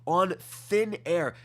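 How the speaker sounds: ordinary talk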